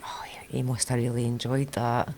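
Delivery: soft whisper under her breath; whispered aside. Retold or imitated. Mum whispering to herself about the dog, hushed. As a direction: sneak it out so daughter won't hear.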